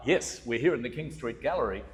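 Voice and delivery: ordinary talk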